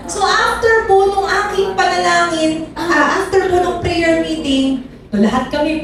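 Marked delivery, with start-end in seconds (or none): none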